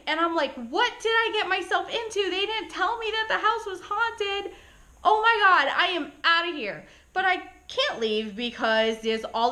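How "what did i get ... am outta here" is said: high-pitched, incredulous alarm; retold scared panic. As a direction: act out dawning panic, ready to bolt. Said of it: "So I am scared as heck".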